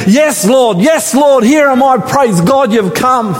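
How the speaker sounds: high, eager relieved reply; eager Abraham. Retold or imitated. Abraham answering the Lord, eager and relieved. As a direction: answer like a man rescued in joy. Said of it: "you can imagine".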